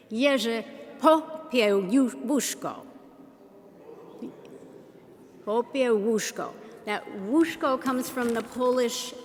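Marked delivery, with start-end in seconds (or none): 0.1-6.5 "Jerzy Popiełuszko" slow and distinct, YEH-zheh pop-yeh-WOOSH-ko; phonetic pronunciation. Shown as a demonstration. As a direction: teach "Jerzy Popiełuszko" syllable by syllable, ł as W. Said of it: "the phonetic pronunciation".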